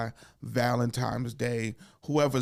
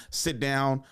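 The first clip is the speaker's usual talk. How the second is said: normal talking voice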